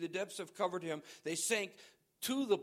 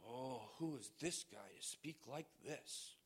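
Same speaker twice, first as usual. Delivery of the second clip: much softer, lower, sneering murmur; mock scoffer; 6 semitones lower, 12 dB quieter than usual. Preacher mimicking a skeptic in the pews, muttered. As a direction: mutter it like a doubting critic. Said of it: "This is who the Holy Spirit is".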